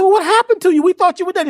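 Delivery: very high, astonished shout; amazed frogs. Acted. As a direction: call out in disbelief to the deaf frog. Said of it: "They said".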